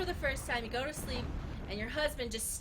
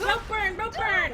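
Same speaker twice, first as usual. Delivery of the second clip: yelling in pain; 10 semitones higher, 9 dB louder, slower than usual. Retold or imitated. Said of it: "then I'm like".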